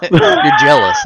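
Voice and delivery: laughing through the words. Real, not acted. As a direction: tease while cracking up. Teasing co-host with laugh.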